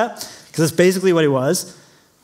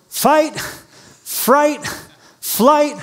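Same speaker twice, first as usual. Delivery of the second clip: rising list; 8 semitones higher, much slower than usual. Shown as a demonstration. Listing words in a climb.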